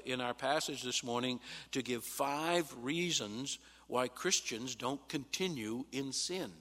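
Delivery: ordinary talk, nothing marked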